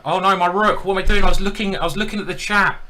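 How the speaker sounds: yelling at his own blunder; real panic over a lost rook; alarmed outburst. Real, not acted. Blunders his rook mid-game.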